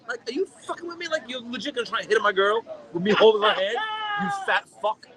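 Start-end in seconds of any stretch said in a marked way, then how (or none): none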